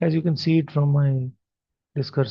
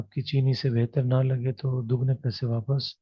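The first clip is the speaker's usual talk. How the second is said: rattled off fast like a TV ad tagline; quick recited Hindi slogan; quoted ad slogan; much faster than usual. Retold or imitated. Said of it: "Sugarlite says".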